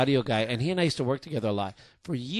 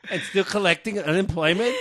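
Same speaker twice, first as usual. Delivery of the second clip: hammed-up callous boss, louder and higher, laughing through it; a laughing put-on of a boss who doesn't care; mock heartless boss; 4 semitones higher, 4 dB louder, slower than usual. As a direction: play the cold boss for laughs, cracking up.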